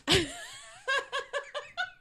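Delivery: high burst of laughter. Real, not acted. Laughs at panic memory.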